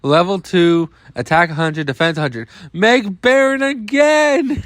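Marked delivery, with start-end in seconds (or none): 2.7-4.6 high, drawn-out pretend scream; hammed-up cry, stretched and pitched way up; mock scream. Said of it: "This card literally just screams".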